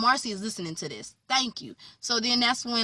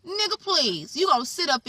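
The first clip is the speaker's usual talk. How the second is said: a high, exasperated scoff; scoffing dismissal; 8 semitones higher than usual. Real, not acted. Viewer dismissing Randall's excuse, high scoffing. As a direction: wave him off in disbelief.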